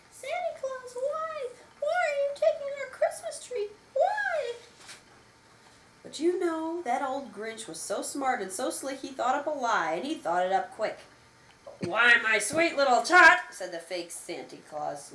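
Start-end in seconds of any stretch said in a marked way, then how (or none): 0.1-4.5 put-on high small child's voice, pleading; squeaky little Cindy Lou Who voice; little girl voice. A narrator's reading. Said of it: "She stared at the Grinch and said". 11.8-13.4 sweet lying Santa voice, louder; sugary villain pretending to be Santa; sly fake Santa. A narrator's reading.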